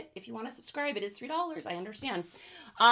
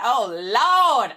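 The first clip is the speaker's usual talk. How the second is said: Hustle's catchphrase; 7 dB louder than usual. Retold or imitated. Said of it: "I blame Hustle for the oh lords".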